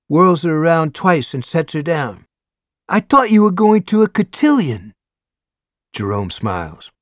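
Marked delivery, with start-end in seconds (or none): 2.9-4.9 high, loud excited question to a brother; excited sister. A narrator's reading. Sister surprised to see her brother home, excited.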